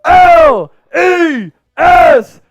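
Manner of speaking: slow, loud letter-by-letter spelling; shouted spelling cheer. Real, not acted. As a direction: belt out each letter like a stadium chant.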